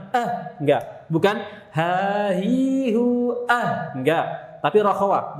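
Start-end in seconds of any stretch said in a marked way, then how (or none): 0.1-0.6 "Ah" stopped dead; short "Ah" with no breathy H; held, cut-off sound. Shown as a demonstration. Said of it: "Kalau syiddah ditahan". 1.8-3.9 long, higher "ha, hi, hu", then "ah" stopped short; chanted syllables ending in a blocked "ah"; cut-off ending. Shown as a demonstration. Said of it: "Bukan syiddah".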